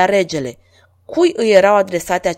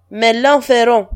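interrupting; 4 semitones higher, 6 dB louder than usual. A narrator's reading. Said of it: "întrerupse regele".

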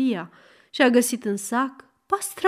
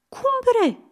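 astonished cry; 14 semitones higher than usual. Acted. Cadi astonished.

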